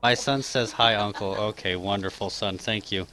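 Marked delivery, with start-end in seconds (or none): none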